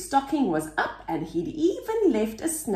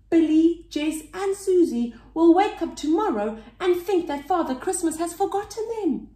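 worried; 7 semitones higher, 3 dB louder than usual. A narrator's reading. Slug worries about children.

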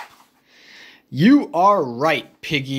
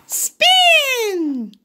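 high little pig call; Piggy calling the spin to Gerald, high voice. Acted. Piggy calls the move.

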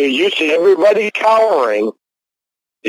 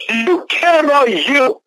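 high, pleading outburst; real heated high voice; angry yelling; 6 semitones higher than usual. Real, not acted. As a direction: yell it as an exasperated appeal. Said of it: "Why are you yelling".

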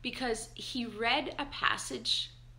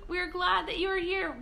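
moved almost to crying while greeting; choked up; 7 semitones higher than usual. Real, not acted. Greeting church family online, choked up. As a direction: voice catching with real tears, a touch higher. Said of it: "I'm gonna get all teary-eyed".

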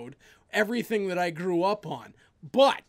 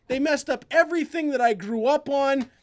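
normal talking voice

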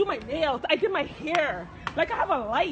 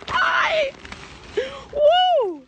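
real exclamation, pitched way up; high whoop; 8 semitones higher than usual. Real, not acted. Calling out in excited disbelief on camera.

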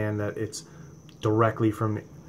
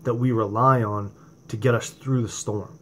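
louder, impassioned preacher voice that drives each question home; fiery preaching; 3 semitones higher, 5 dB louder than usual. Acted. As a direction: preach it at full force to the congregation. Preaching to viewers about testing.

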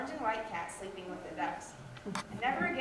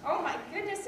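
higher surprised exclamation; exclaiming; 8 semitones higher, 3 dB louder than usual. Acted. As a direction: gasp it out in relief. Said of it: "exclaimed the farmer's wife".

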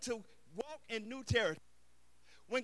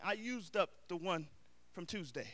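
ordinary talk

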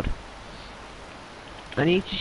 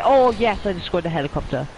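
loud, high burst of joy; excited cheer; 4 semitones higher, 4 dB louder than usual. Real, not acted. Hits helicopter with rocket.

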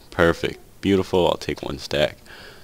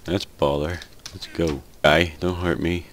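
ordinary delivery

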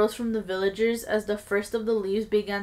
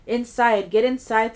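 louder narrated yell, held in; 2 semitones higher, 7 dB louder than usual. A narrator's reading. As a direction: urge them inside with raised voice. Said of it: "someone yelled".

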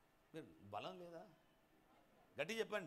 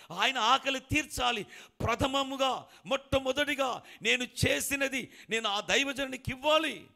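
loud, high, slow preaching at full force; fervent preaching; 6 semitones higher, 12 dB louder than usual. Acted. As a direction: preach her faith with all your might. Fervent preaching of widow's faith.

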